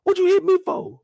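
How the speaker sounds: high whining question; whiny Doughboy. Retold or imitated. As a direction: whine it like a hurt kid.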